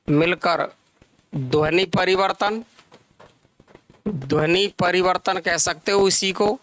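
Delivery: measured teacher dictation with long pauses. Real, not acted. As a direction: dictate phrase by phrase, leaving time to write. Dictates the definition to students.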